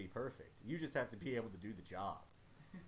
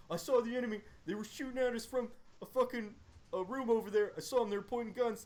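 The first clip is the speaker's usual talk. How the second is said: raised, jumpy mock insistence; insistent soldier; 10 semitones higher, 5 dB louder, faster than usual. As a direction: soldier insists he saw the enemy, higher and jumpy. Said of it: "He's like".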